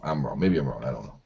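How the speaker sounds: usual speaking voice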